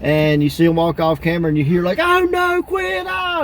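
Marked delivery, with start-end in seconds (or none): 2.0-3.4 very high, squealing cry of a bullied student; mock scream. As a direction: shriek it off camera, begging him to stop. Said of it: "the guy's screaming".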